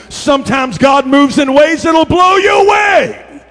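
loud, high, drawn out; preaching shout. Real, not acted. Preacher rousing the church about God's ways, climactic shout. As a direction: build to a roaring, stretched finish.

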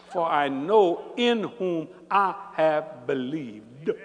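very slow, word-by-word scripture; measured, drawn-out declaration of faith; slow, deliberate recitation. Real, not acted. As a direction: spell out the conviction one word at a time. Deliberate emphatic quote of scripture.

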